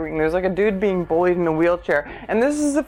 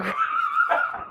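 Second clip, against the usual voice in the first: very high squealing horse whinny; mock whinny, far up in pitch; imitated horse noise; 5 dB quieter than usual. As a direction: answer as the horse with a thin squeal. Imitating the old horse's reply.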